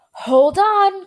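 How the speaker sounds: high, loud cry of warning; cried out. A narrator's reading. Wonka warns the others, high loud cry. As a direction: call out to hold on. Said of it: "cried Mister Wonka".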